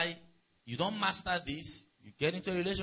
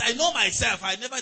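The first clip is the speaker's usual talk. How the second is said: loud, higher boastful claim; mock boast; 6 semitones higher, 8 dB louder than usual. Preacher mimicking a child claiming innocence, loud mock boast. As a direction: belt out a child's proud denial.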